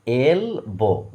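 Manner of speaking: "এলবো" drawn out slowly as এল, বো; two separate syllables. Shown as a demonstration. Teacher shows learners both syllables, slowly separated. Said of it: "দুটো syllable আছে কিন্তু".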